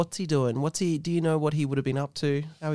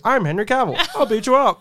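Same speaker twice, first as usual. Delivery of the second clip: higher, punchy talking-toy threat; Henry Cavill; 5 semitones higher, 5 dB louder than usual. Acted. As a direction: play a push-button action hero making threats. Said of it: "you press the button and goes".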